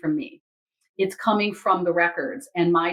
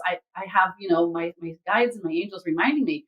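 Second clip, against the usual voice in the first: ordinary talk, nothing marked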